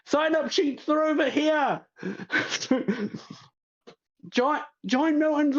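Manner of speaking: high call over the noise; the host calling to the crowd. Acted. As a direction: shout it before anyone listens.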